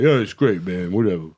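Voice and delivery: a lightly voiced Van Damme, tossed-off and casual; Jean-Claude Van Damme impression. Telling about the Van Damme premiere meeting, a light mock Van Damme. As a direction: shrug it off like a bored action star. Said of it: "That's not Jean-Claude Van Damme".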